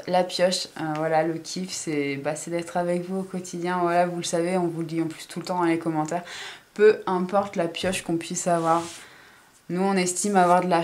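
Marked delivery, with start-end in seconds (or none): none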